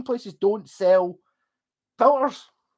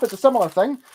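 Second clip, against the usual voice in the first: usual speaking voice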